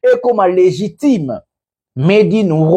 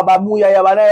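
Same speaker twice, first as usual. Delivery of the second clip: ordinary talk, nothing marked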